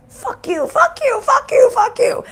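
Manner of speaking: high, shouted mock family fight; angry Italian yelling. Mimicking her loud Italian family fighting, in a mock shouting match. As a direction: imitate the family screaming insults at each other. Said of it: "We're very loud, angry Italians".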